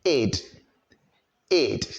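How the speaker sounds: "id" said as a short separate syllable; clear "id" ending. Shown as a demonstration. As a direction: model the ending "id" for learners. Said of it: "pronounce the E D there as".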